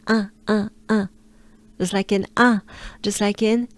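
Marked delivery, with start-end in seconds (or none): 0.1-1.1 un as a short nasal French vowel. Shown as a demonstration. Teacher drilling the added sound, French nasal un. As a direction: repeat un nasally, no n heard. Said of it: "you add the un sound".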